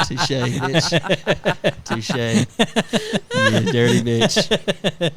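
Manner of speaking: cracking up while conceding the jab; mock insult squeezed out between laughs; laughing concession. Conceding daughter's joke, cracking up.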